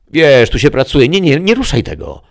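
a sharp demon warning off a careless guest; devil guide. Acted. (diabeł ostrzega gościa, podniesionym głosem)

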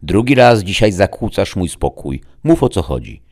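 scold, then demand the report; stern rebuke. A narrator's reading. Lord disturbed by his servant, stern.

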